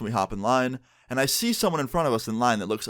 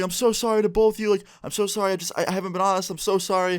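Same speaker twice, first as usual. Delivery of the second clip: higher, tearful mock sobbing apology; imitating crying girlfriend; 9 semitones higher than usual. Girlfriend caught cheating apologizing through tears, mock crying. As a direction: voice her choked up and pleading, much higher. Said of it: "Emma starts crying".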